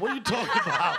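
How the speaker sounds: loud, high laughing disbelief; laughing protest. Real, not acted. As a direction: scoff through laughter.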